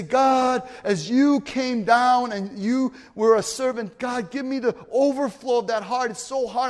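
impassioned pulpit prayer; fervent prayer. Acted. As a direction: plead with God from the pulpit. Praying aloud in sermon.

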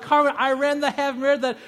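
bursting to tell Carmen the news; excited boast. Retold or imitated. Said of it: "I'm like".

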